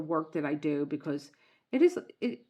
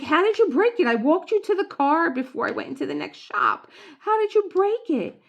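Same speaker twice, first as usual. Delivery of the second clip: high, loud disbelieving cry at her husband; exasperated shriek; 12 semitones higher, 10 dB louder than usual. Retold or imitated. Wife shrieking at husband over broken cup, loud and high. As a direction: demand it shrilly, outraged. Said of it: "And I was like".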